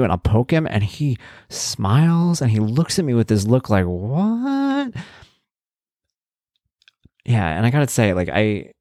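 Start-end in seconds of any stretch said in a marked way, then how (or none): 3.8-4.9 put-on high, curious what from the boy; puzzled child. Son looking at dad after a poke, puzzled. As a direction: voice the son's puzzled look.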